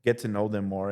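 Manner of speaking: ordinary delivery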